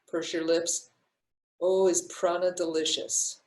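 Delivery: calm, unhurried breathing-coach voice; guided breathing. Acted. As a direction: guide the breath gently with pauses. Leads a breathing exercise.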